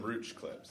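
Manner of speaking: "rooch" said with an oo vowel; mock-Canadian "rooch" for roach; joking Canadian pronunciation. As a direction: say "rooch" to rhyme with pooch, as a joke. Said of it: "it has a U in it".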